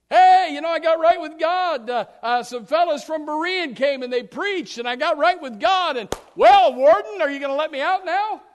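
loud, high, eager plea; hopeful prisoner. Acted. Prisoner asking the warden for release, loud eager plea. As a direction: ask the warden hopefully to go free.

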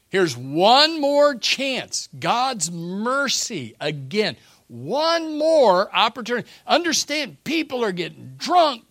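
high, loud, slow preacher's cry; fiery preaching. Acted. Preacher pleading to congregation about last chance, fiery. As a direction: preach it with full fire.

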